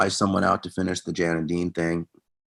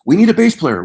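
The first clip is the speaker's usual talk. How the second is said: raised, higher voice calling for a bass player; a singer shouting to the band for a bass player; calling out loud; 10 semitones higher, 7 dB louder than usual. Retold or imitated. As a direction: call across the tent for a bass player.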